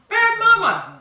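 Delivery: high, loud playful self-scolding; cheerful loud scold of a silly owner; loud mock scold. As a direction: bark it at yourself in fun. Self-scolding while playing with puppy.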